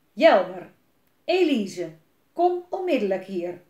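loud angry call across the yard; dad bellowing for his children; angry shout. Acted. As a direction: summon the kids with a furious shout. Father calling children angrily.

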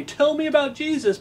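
high, pleading mock request; mock eager plea. Preacher mocking lazy believers with a pleading stranger. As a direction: squeak it out like someone begging to be told. Imagined stranger begging to hear.

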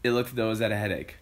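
plain reading voice

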